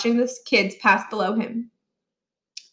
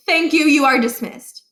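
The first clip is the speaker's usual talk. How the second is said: curt dismissal; 5 semitones higher, 5 dB louder than usual. A narrator's reading. Barkin dismisses Niles.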